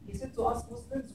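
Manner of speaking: normal talking voice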